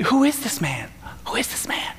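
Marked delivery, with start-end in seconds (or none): 1.3-1.9 hushed, breathless whisper. Retold or imitated. Disciples whispering about Jesus while he sleeps, hushed whisper. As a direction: whisper it so Jesus won't hear. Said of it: "conversation about".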